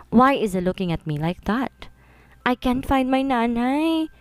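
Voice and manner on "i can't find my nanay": put-on slow, higher, tearful little boy; lightly voiced crying child, dragging the words. Lost boy telling a stranger he can't find his mother, tearful. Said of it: "Stop crying".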